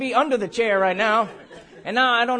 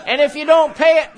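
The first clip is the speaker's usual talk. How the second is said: louder, higher mock bill collector; threatening creditor; 5 semitones higher, 8 dB louder than usual. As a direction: press a mock threat over laughter.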